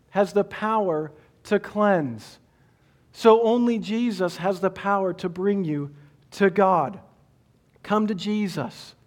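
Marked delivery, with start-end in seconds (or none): none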